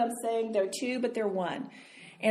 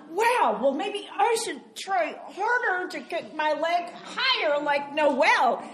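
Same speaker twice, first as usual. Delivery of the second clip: higher, slow, mock-envious musing; 7 semitones higher, slower than usual. Dancer comparing herself to Noelle, mock envious and slow. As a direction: sound comically outdone.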